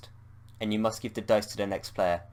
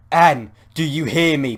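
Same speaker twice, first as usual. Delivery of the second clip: slow, higher, much louder yell; 6 semitones higher, 12 dB louder, slower than usual. Acted. Bob yelling to snap Anne out of it. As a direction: yell to break her trance. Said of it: "Bob yells".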